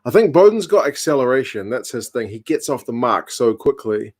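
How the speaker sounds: normal talking voice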